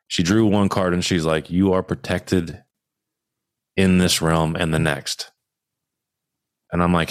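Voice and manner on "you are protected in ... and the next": slow, measured, with a long pause midway; spaced-out, deliberate quote; retold solemn pronouncement. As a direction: deliver it like a reader's verdict, unhurried. Voices tarot reader's solemn pronouncement.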